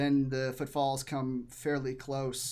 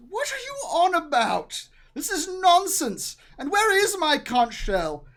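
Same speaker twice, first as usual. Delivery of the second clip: high, loud scolding voice; loud, high-pitched haughty outrage; indignant lady; 9 dB louder than usual. Acted. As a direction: scold your husband in a raised, imperious voice. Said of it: "She says".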